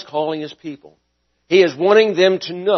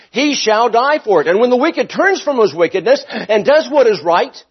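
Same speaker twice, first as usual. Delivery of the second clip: higher, louder preaching; 7 semitones higher, 4 dB louder than usual. Acted. Pastor confronting his hearers about God's justice, higher and louder. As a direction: drive it home from the pulpit.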